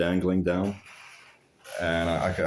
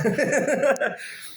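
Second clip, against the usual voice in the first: high laugh. Real, not acted. Laughing at stepsister's reaction.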